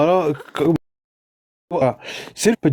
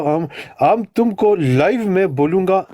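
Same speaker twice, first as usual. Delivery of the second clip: usual speaking voice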